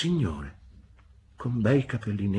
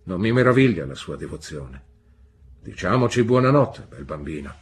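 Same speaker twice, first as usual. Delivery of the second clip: lightly voiced gentleman, louder; deep-voiced gentleman; 3 semitones lower, 7 dB louder than usual. A narrator's reading. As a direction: address the boy as a hearty gentleman. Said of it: "nella sua profonda voce".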